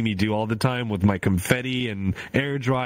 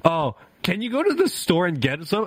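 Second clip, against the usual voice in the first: higher-pitched mock husband asking a favor; imitating husband; 8 semitones higher than usual. Streamer mimicking husband's store request, mock wheedling. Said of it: "my husband's like".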